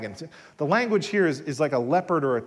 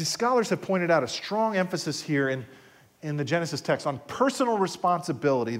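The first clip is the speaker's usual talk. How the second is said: normal talking voice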